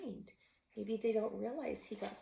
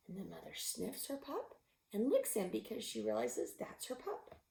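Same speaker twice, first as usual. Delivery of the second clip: usual speaking voice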